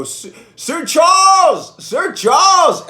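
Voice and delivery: long, high drawn-out shout; re-enacted hailing of a celebrity; calling out. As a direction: holler the name out of a car window. Calls out from the car window.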